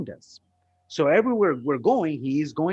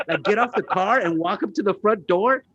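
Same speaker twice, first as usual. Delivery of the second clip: retold disbelief; 8 semitones higher, 4 dB louder than usual. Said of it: "I'm like".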